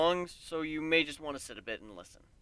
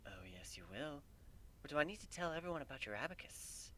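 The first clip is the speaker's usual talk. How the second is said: unvoiced, much softer sly threat; threatening whisper; 15 dB quieter than usual. A narrator's reading. Narrator threatening Luna in a whisper. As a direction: whisper the blackmail close.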